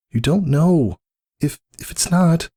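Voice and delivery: high, pleading and stammering; anxious Cirrus. Acted. Cirrus pleads with Whisper, high and faltering. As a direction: beg her, voice rising.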